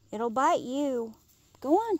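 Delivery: sharp, loud shoo at the dog; urgent warning. Real, not acted. Warning her dog Jack away from the animal. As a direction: raise the voice to send the dog off.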